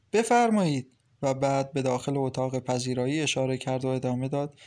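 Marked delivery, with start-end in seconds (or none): 0.1-0.9 quick, higher and louder invitation; hurried. A narrator's reading. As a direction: rush the welcome out eagerly. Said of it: "با عجله گفت". (با عجله و صدای بلندتر)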